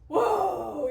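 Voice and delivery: loud, high startled whoa. Retold or imitated. Office coworkers at each thunderclap, loud startled whoa. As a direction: react like the thunder just cracked overhead. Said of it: "everybody was like".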